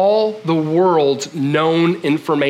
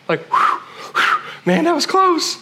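relieved whew; 10 semitones higher than usual. Retold or imitated. Relief after near trouble.

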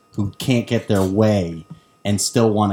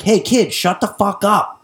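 angry yell; 9 semitones higher than usual. Retold or imitated. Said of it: "guys will be like".

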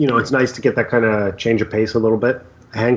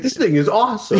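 high goofy imitation; mock dopey voice; 10 semitones higher than usual. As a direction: parrot him in a silly high voice. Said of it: "He's like".

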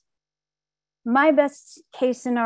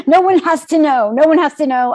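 loud, pitched-up, urgent self-reassurance; frantic insistence; 5 semitones higher, 10 dB louder than usual. Retold or imitated. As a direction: talk yourself into the cover-up, loudly. Hiding the positive test.

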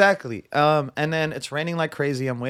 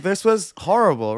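higher, louder exasperated complaint; exasperated venting; 5 semitones higher, 5 dB louder than usual. Retold or imitated. Date venting about a Disney trip, exasperated. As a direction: complain loudly, fed up.

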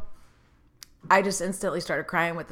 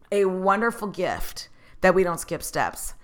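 usual speaking voice

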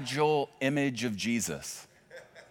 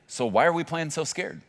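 usual speaking voice

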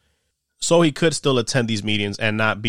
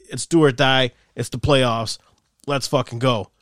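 usual speaking voice

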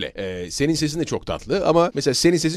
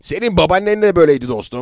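raised, higher dubbing voice; dubbed-film reproach; 6 semitones higher, 4 dB louder than usual. Shown as a demonstration. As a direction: chide a friend like a dubbed movie character. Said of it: "bir ufak bir serzenişi". (tiz perdeden dublaj serzenişi)

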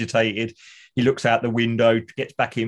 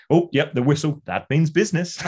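higher mock urgent; quoting Niles; 5 semitones higher than usual. Quoting Niles's whistle line, a bit higher and urgent. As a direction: snap to attention, pitched up. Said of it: "reminds me of Mister Sweeney's mum".

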